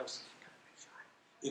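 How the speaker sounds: a timid, breathy whisper; shy whisper. Retold or imitated. Recalling walking into the school, shy whisper. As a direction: whisper it like a shy young kid. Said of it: "you know, like that".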